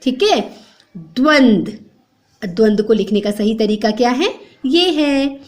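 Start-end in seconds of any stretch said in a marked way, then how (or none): none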